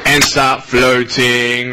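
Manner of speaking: slow, low, drifting delivery; dreamy mock float. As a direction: stretch it out as if floating away. Mimics drifting away in meditation.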